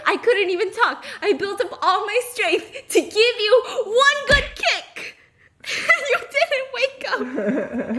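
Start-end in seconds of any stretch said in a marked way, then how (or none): 1.2-8.0 웃음을 참지 못하고 높게 말하는; laughing through the words, pitched up high. Real, not acted. Laughing while retelling kick.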